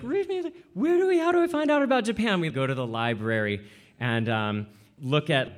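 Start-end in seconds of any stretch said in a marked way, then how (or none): 0.0-2.4 put-on much higher, fast child's plea; eager little kid. Small child asking mom, high and rushed. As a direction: ask it like an impatient small child. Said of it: "so I'm like".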